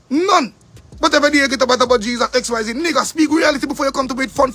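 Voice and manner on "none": "None" louder and much higher; even higher none. Real, not acted. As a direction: push "None" up higher still.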